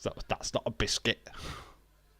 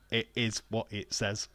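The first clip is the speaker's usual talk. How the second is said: ordinary talk, nothing marked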